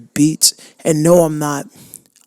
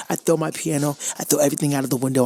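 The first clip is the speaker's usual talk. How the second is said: normal talking voice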